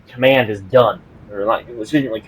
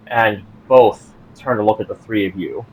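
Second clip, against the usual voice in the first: normal talking voice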